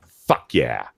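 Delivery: ordinary talk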